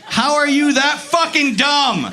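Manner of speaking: slow, high-pitched incredulous cry; exasperated rant. Acted. Comic berates roommate over botched saying, exasperated rant. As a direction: draw out each word in comic outrage.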